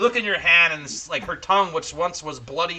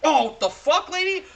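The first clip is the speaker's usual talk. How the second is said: much higher, startled outcry; shocked; 12 semitones higher than usual. Retold or imitated. Young man startled by the crone's tongue, high yelp. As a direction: yelp in disgusted disbelief. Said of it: "he's like".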